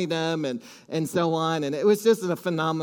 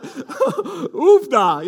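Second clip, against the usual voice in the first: laughing Minnesota oofda, high and loud; a stunned Minnesotan seeing the mansions; Minnesota exclaim; 8 semitones higher, 6 dB louder than usual. Retold or imitated. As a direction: gasp "Oofda" like a Minnesota country bumpkin. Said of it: "All the Minnesota terminology started coming".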